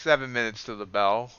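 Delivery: usual speaking voice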